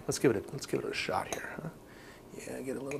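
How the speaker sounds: whispering. Real, not acted. About to taste the dish.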